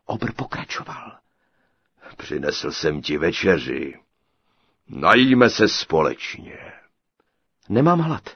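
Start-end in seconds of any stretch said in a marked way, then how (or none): 2.2-6.6 low, slow, loud giant voice; booming giant. A narrator's reading. Giant greeting his captive bride, low slow booming. 7.7-8.3 sharp, higher and louder refusal; curt snap. A narrator's reading. Captive girl refusing the giant's dinner, curt and sharp. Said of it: "ocekla dívka".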